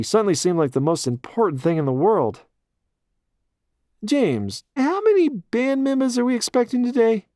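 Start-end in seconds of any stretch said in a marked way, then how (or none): 4.0-7.3 put-on high, thick New York accent; the mayor in a New York accent. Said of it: "he asked in his thick New York accent".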